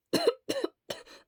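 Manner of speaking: dry hacking cough; harsh cough. Acted. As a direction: cough from a sore throat. Sick character coughing.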